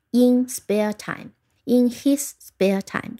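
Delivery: very slow, spaced-out words; model phrase reading. Shown as a demonstration. Teacher drilling a phrase to remember, slow model reading. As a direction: dictate the phrase slowly. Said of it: "今天你要着重记住的是".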